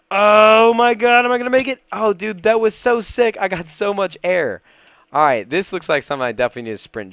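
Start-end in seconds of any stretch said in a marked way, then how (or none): 0.1-4.6 thrilled yell. Real, not acted. Gamer takes a huge leap down, thrilled high yell. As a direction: scream it out mid-fall, then marvel.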